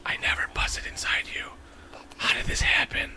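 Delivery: hushed unvoiced aside; whisper. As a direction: whisper it like a secret. Dad mock-whispers aside.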